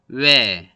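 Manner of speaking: 외 as a gliding "we"; drawn-out 외 changing from w to e; diphthong pronunciation. Shown as a demonstration. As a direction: let the lips move through 외. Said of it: "변하고 있죠".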